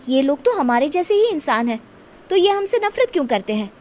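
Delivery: put-on young girl voice.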